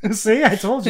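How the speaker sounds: words through real chuckles; laughing. Real, not acted. Comic pleased his joke got a laugh, laughing. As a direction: say it while cracking up.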